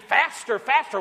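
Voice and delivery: mildly excited mock kid cheer; happy screaming kids. Pastor imitating kids on the ride, mock happy cheer. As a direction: imitate thrilled children, without really screaming. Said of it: "They're all screaming, happy".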